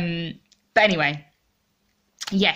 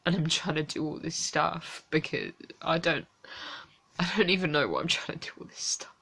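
quiet, tearful and worn out; exhausted, close to crying, barely above a murmur; near tears; 7 dB quieter than usual. Real, not acted.

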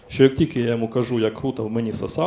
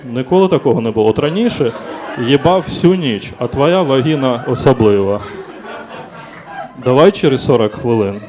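much louder, comically insistent protest; loud mock excuse; 2 semitones higher, 10 dB louder than usual. Man excusing himself to a date after finishing early, loud insistent protest. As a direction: protest loudly, as if making excuses.

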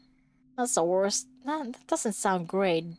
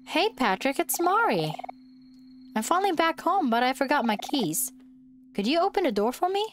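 higher, bright sweet caller at the door; sweet girl voice; 6 semitones higher than usual. Acted. Mari asking Patrick to open the door, higher sweet voice. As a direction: coax Patrick to open up, voice pitched up.

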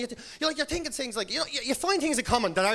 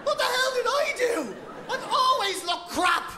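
much higher, slow exasperated protest; mock outrage; 12 semitones higher, slower than usual. Comic to crowd, hammed-up baffled boyfriend.

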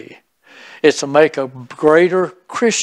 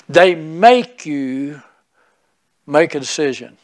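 slow halting emphasis. Acted. Halting delivery of main point.

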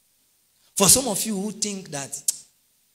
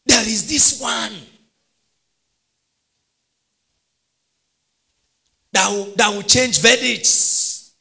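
full-force preaching; 6 semitones higher, 10 dB louder than usual. Acted. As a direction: preach it out to the whole hall.

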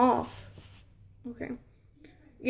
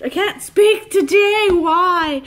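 frustrated outburst; 6 semitones higher, 6 dB louder, slower than usual. Real, not acted. Laughing at her own stumbles.

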